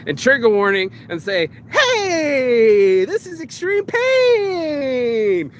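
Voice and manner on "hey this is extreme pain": hammed-up wail, high and drawn out slowly; mock crying. Vlogger acting out pain to his viewers, mock wailing. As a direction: cry it out like a wounded man.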